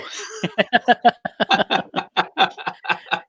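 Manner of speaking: a long, high, real laugh; laughing at your own joke. Real, not acted. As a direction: let a high laugh run out.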